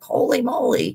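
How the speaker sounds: high, startled exclamation; mock-shocked holy moly; shocked exclaim. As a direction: gasp it out in happy shock. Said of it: "I was shocked".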